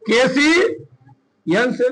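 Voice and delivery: KCN से stretched, letters held long; KCN से very slowly, letter by letter; slow spelling-out. Shown as a demonstration. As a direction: draw out KC, pause, then finish KCN से. Formula repeated slowly while writing.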